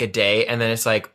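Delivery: ordinary delivery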